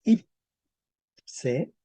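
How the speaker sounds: "ipse" split into ip and se with a long gap; syllable by syllable. Shown as a demonstration. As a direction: say "ipse" slowly as two separate syllables, ip... se. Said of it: "sería ipse".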